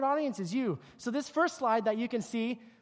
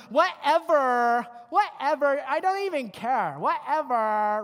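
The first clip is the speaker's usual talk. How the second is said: louder, drawn-out bored dismissal; dismissive whatever; 2 semitones higher, 6 dB louder, slower than usual. Retold or imitated. Teen girl brushing off her parents, drawn-out mock whatever. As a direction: brush them off, slow and loud. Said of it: "they didn't invent the term".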